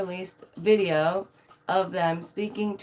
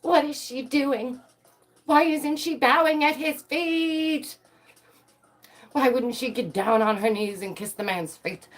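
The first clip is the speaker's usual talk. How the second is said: mock swooning devotee; 8 semitones higher than usual. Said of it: "Some girls are literally fanning themselves off like".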